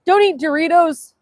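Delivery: mock command. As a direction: proclaim it like a propaganda slogan. Mock propaganda, then calm repeat.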